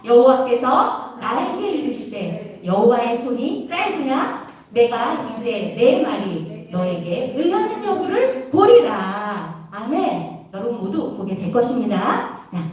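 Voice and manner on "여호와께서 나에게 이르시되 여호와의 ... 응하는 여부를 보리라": slow, low, measured reading aloud; verse recitation. Real, not acted. Preacher leading children in memory verse, slow recitation. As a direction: lead the verse slowly, phrase by phrase.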